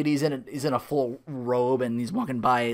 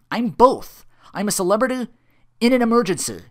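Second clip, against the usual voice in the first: louder, higher self-important demand; indignant insistence; 10 semitones higher, 11 dB louder than usual. Retold or imitated. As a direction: insist you matter, pushing hard. Phil demanding on the payphone.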